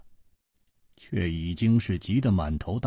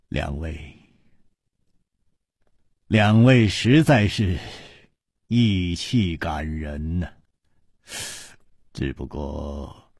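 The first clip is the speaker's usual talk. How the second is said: slow, measured admiring words; slow, knowing praise. Acted. Li Yuhan praises the two friends' loyalty, slow and measured. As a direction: praise them with a hint of irony.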